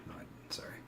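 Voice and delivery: whispered to himself, correcting a mistake; whispered aside. Real, not acted. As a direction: drop to a whisper, checking the chart.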